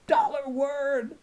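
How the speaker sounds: high, slow, loud triumphant cry; thrilled call of a found treasure; excited exclamation. A narrator's reading. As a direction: announce it like you just struck gold. Excited discovery in narration.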